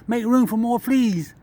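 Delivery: high, playful squirrel voice; squirrel's voice. Acted. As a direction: pitch it up as a squirrel ordering fleas about. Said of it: "That squirrel said".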